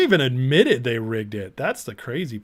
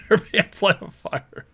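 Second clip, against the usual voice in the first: laughing; 7 semitones higher, slower than usual. Real, not acted. Reading a funny chat rule.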